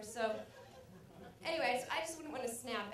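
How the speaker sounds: usual speaking voice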